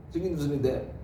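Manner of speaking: 청긴 우선인데 said again through a laugh; laughing repeat. Real, not acted. Preacher laughs at his own slip while repeating 청긴 우선인데.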